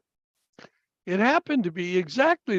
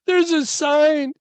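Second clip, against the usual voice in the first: put-on high child's protest, building louder; mock tearful boyish plea; tearful little boy; 9 semitones higher, 4 dB louder, slower than usual. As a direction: imitate a crying son pleading, slowing at the end. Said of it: "he burst into tears".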